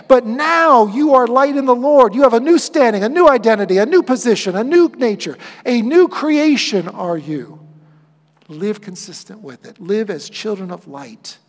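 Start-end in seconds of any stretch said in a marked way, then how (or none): 0.1-7.6 each "new" louder and falling; stress on new. Real, not acted. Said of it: "preaching list, stress new".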